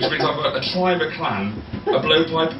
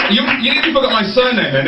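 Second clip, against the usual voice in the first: normal talking voice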